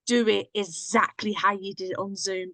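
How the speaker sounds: usual speaking voice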